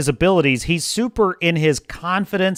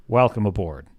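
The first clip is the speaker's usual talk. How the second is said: hearty welcome; 9 semitones lower, 4 dB quieter than usual. Real, not acted. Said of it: "repeated greeting".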